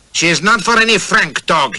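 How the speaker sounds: jealous warrior shouting at the khan, furious; angry shout. A narrator's reading. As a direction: shout him down in jealous rage. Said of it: "he shouted".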